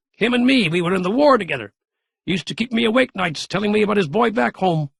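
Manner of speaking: the grandfather spinning a yarn; a lightly voiced old soldier; spry old Gramp. A narrator's reading. Gramp's voice in the story.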